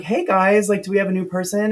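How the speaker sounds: high, bubbly greeting; perky school director saying hello, voice lifted; chirpy greeter. Retold or imitated. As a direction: greet them brightly and high. Acted school director greeting the car.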